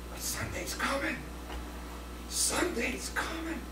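soft whispered "Sunday's coming"; whispered refrain. Retold or imitated. Preacher whispering the Sunday refrain to the congregation. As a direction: let the hope drop to a whisper.

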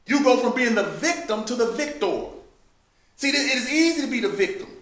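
loud, rising sermon voice, driving each point home; preaching at full force. Acted. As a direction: preach it to the saints with fire.

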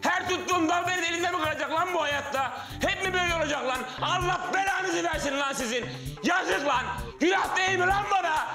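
high-pitched, anguished shouted rant; full-blown escalation. Acted. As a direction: wail in outrage like a man betrayed by everyone. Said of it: "yüksel [name]".

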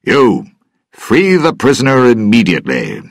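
loud, high-pitched command. Acted. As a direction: call the order out from the door. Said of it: "said a loud voice from the door".

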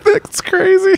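very high-pitched disbelieving squeak; sudden high squeal of disbelief; shocked squeal. Real, not acted. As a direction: squeak it out in horror. Reacting to the mouse story.

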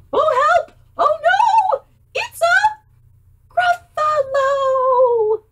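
high, loud, drawn-out cry; mock panic. Mouse cries out on seeing the Gruffalo. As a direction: shriek it in hammed-up terror.

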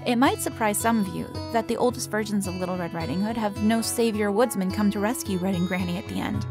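plain reading voice

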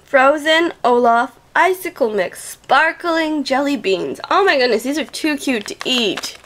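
usual speaking voice

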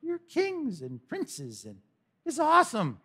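high, slow mock awe; gushing, sing-song sarcasm; sarcastic. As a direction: draw it out in fake wonder. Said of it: "All that sarcasm".

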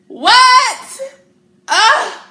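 amazed shout. Real, not acted. Marvelling at the Bible verse, shouting in amazement. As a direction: gasp it out in disbelief.

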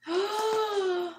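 high, drawn-in gasp; delighted gasp. Real, not acted. Vlogger unwrapping sweets from her friend, delighted gasp. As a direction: gasp at a lovely surprise.

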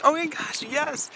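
high, bright over-the-top eagerness; mock eager yes. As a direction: gush it like you're thrilled to help. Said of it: "I'm like".

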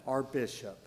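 usual speaking voice

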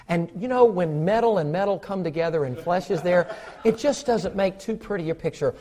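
soft, sweet, overly courteous voice with laughs; sugary politeness, chuckling; mock polite. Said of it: "I don't think the Lord is saying".

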